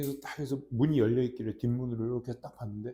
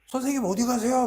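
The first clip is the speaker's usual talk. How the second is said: put-on high, louder eager kid question; curious kids asking; 10 semitones higher, 5 dB louder than usual. Unknown kids question the visiting dad, eager high voice. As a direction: ask like a keen student. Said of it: "그러는 거야".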